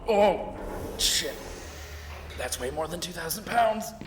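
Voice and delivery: very loud, squeezed under the weight; strained groan. Acted. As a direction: gasp as the weight lands. Crushed by the door's weight.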